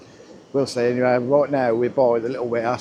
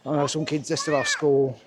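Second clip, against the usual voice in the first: ordinary talk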